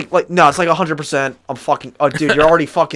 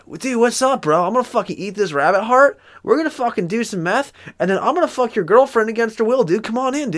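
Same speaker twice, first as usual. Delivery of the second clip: high, lighthearted stoner bro; cheery high bro voice inviting a guest in; laid-back bro voice; 6 semitones higher than usual. Retold or imitated. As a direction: chirp it like a carefree dude bro. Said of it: "You think he talked like that?".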